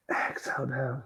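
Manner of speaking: yoga teacher whispering the exhale to the class; soft whisper. Real, not acted. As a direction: breathe the words out on a whisper. Breathing cue in yoga flow.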